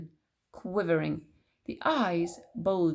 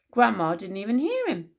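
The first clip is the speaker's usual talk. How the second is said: ordinary delivery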